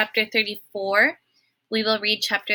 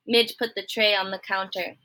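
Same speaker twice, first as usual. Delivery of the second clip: ordinary delivery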